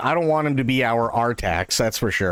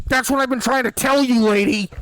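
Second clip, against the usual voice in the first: much higher and louder, fed-up clerk finally snapping; exasperated yell; 11 semitones higher, 4 dB louder than usual. Retold or imitated. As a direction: let it all out at the customer. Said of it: "And he goes".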